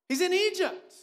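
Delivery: high, loud burst of disbelief; outraged exclamation. Real, not acted. Climax of the preacher's outrage.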